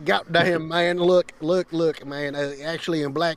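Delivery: put-on rambling Southern good-old-boy talk; Southern accent. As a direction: keep the Southern accent while telling a story. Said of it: "I did a, the Southern".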